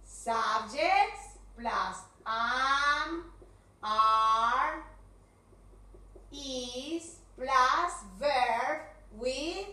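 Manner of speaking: each term stretched out; half-speed teacher dictation with pauses; slow formula dictation. Shown as a demonstration. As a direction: spell out the formula very slowly.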